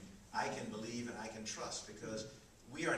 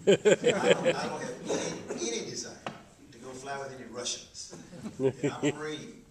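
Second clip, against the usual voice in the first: laughing protest; 2 semitones higher, 13 dB louder than usual. Retold or imitated. Marine refusing a mentor, laughing loudly. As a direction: laugh through a stubborn no.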